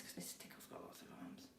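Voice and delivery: fast, soft whispered aside; a quick whispered aside. Real, not acted. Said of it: "whispered aside".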